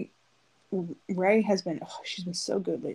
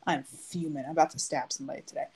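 ordinary talk, nothing marked

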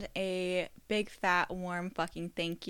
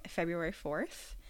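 ordinary talk, nothing marked

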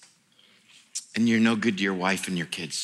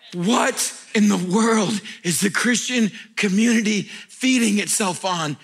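normal talking voice

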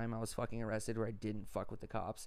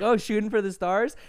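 taunting cop; 8 semitones higher, 10 dB louder than usual. Retold or imitated. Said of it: "I was like".

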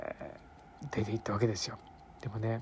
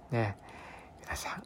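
ordinary talk, nothing marked

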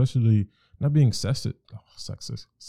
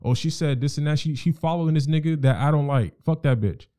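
higher, fast and scornful; imitating a careless boyfriend; dismissive guy; 6 semitones higher than usual. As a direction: brush her off with contempt. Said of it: "where they're like".

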